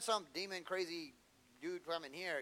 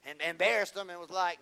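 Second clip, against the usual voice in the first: normal talking voice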